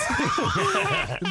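high shrieking laugh; loud burst of laughter. Real, not acted. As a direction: crack up loudly. Laughs at the dad story.

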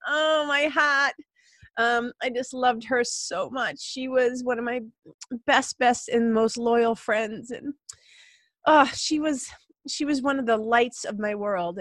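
welling up, pitch lifted and words drawn out; real emotional. Real, not acted. Podcaster remembering her dead dog, getting emotional. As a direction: overcome with love and grief, voice riding high. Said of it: "I'm getting emotional thinking about it".

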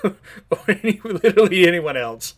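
loud, high, laughing disbelief; incredulous laughter. Real, not acted. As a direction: burst out loud, cracking up in disbelief. Cracks up mocking the greatest Avenger claim.

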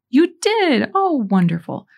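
high-pitched surprised question, easing into delight; retold astonishment, leaping high then settling; surprised priest. Forgetful priest learns he heard her confession, surprised.

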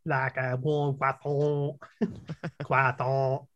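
put-on slow, over-the-top French accent; thick French accent. Recalling the Sealab Frenchman, absurd French accent. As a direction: drag out croissant in a French accent. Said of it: "absurdly thick French accent".

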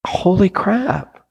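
high, slow holy crap; stunned realization. Retold or imitated. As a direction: let the shock sink in. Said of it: "I'm like".

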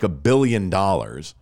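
ordinary talk

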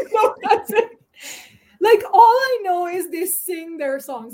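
normal talking voice

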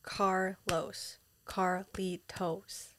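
slow, separated syllables, said softly; softly sounding out each syllable. Shown as a demonstration. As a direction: break Carlos and Carlitos into slow, even syllables.

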